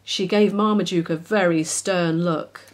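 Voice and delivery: ordinary delivery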